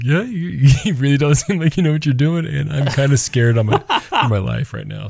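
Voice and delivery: mock worried, laughing as he speaks; giggling while talking; laughing through words. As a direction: chuckle through the line. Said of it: "I'm like".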